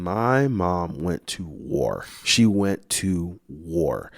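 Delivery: slow, weighty storytelling emphasis; drawn-out emphasis. Real, not acted. Daughter relishing how her mom fought for her, drawn-out emphasis. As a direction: stretch each word out for effect.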